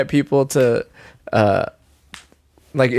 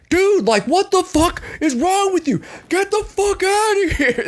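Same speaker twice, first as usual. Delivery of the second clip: high, exasperated shout retold; angry outburst. Telling a stranger off outside, retold angry outburst. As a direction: blow up at someone, voice jumping way up. Retelling his own angry blowup.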